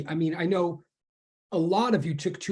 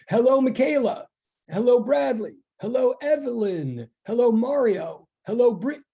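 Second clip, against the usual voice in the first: higher-pitched roll of hellos, each falling away; calling out greetings; 4 semitones higher than usual. Real, not acted. Teacher greeting students as they come into online class, calling out. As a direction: greet each one by name in a raised, lilting call.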